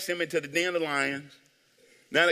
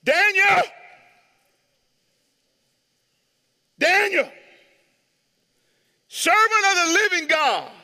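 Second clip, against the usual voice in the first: loud, high anguished calling of a name; desperate king calling down into the pit; lamentable cry; 10 semitones higher, 5 dB louder than usual. Acted. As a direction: cry out to him in grief, across the den. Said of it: "he cried out in a lamentable voice".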